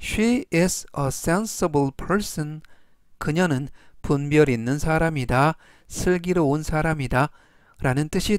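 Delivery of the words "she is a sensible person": She is a sensible person read very slowly, word by word; teacher's slow, deliberate model read; slow model sentence. Shown as a demonstration. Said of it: "전체적 의미는".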